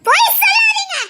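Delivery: shrieking high and loud at her husband; angry shout. Acted. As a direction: scream the accusation at him. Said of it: "ஏன் கத்துற?". (கணவனிடம் கோபமாக கத்துதல்)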